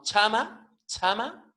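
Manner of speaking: "chama" with breath after the CH; breathy ch-h starting "chama"; aspirated CH. Shown as a demonstration. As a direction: let air rush through the CH of "chama". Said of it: "C H aspirada".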